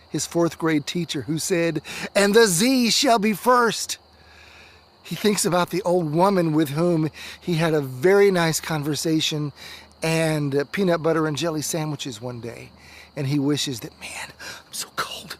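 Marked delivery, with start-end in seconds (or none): 2.1-4.0 proclaiming teacher. Acted. Teacher quoted in memory. 14.0-15.4 freezing whisper. Acted. Freezing boy lost in sleet, whispering.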